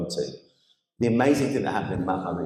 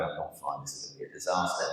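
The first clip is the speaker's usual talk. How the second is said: inner voice; 3 semitones lower, 8 dB quieter than usual. Retold or imitated. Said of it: "Inside, I'm going".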